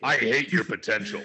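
villain sneer, anime rival style; overacted anime dub. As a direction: play the scornful anime rival. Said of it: "You sound like some douchebag anime character".

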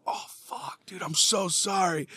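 hushed, embarrassed sorry; mortified under-breath apology; whispered apology. Retold or imitated. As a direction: whisper it like you just blundered.

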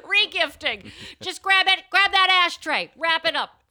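put-on a mom telling her kid to regift the ashtray; bossy mom. Playing the party mom.

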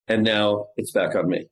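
ordinary talk, nothing marked

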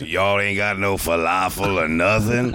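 slow, drawled friend impression; lightly mocking friend voice; imitating his friend. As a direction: mimic your buddy's lazy complaint. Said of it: "You do a better impersonation of me".

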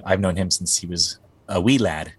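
ordinary delivery

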